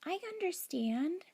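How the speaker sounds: high squeaky hamster voice; hamster squeak. Acted. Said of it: "I squeaked".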